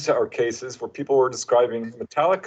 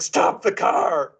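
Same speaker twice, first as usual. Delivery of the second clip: slow, urgent mock order to stop; urgent command; 5 semitones higher, much slower than usual. Contactee friend urgently orders driver to stop, slow and insistent. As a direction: imitate her demanding the car stop now. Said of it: "and she's like".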